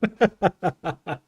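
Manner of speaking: loud, high laugh; burst of laughter. Real, not acted. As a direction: laugh out loud in a quick staccato run. Laughs after falling off cliff.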